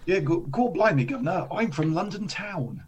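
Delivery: hammed-up London Cockney accent; mock Cockney. Jokes about being from London.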